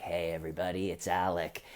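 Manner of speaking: mock muted star, correcting himself; Alec Baldwin. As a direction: address followers in a subdued, fumbling voice. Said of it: "Alec posted a video where he was like".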